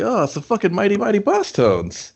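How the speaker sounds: high-pitched fan excitement; retold excited outburst; super excited. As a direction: relive spotting a favorite band, voice way up. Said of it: "this is what I got super excited".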